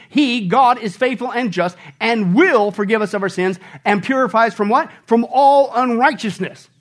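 loud, high preaching, building to a peak; full-force preaching. Acted. Pastor urging the congregation to confess, preaching hard. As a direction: preach it out to the whole room.

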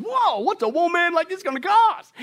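Adam stunned at the offer; amazed Adam. Acted. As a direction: gasp out the question in awe.

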